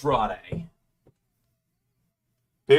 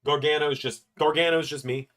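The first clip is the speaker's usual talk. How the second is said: ordinary talk, nothing marked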